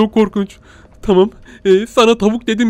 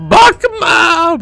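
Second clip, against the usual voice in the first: sudden shout; 11 semitones higher, 6 dB louder than usual. Real, not acted. Yells at monster.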